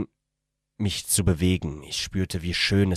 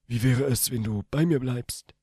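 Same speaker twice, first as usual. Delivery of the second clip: put-on young man's voice; 7 semitones higher than usual. A narrator's reading. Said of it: "zog sie enger zu mir und meinte".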